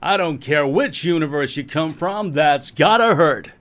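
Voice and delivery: loud, high American announcer; hammed-up sports commentary, stretching the ending; pod-race commentator. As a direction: blare it out like an excited race caller. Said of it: "Those things that were commentating the pod racing".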